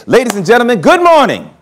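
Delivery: lightly hammed-up announcer greeting; mock train announcement. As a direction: announce it like you're greeting a packed subway car. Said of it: "and just say".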